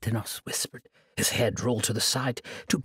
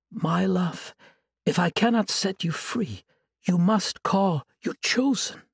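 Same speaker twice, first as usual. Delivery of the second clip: sweet, higher and innocent; angel voice; 5 semitones higher, 3 dB louder than usual. Acted. Sorceress faking an angel's voice to lure her captive, sweetly. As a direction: coax him like a gentle angel. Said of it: "voice of the angel Illyria".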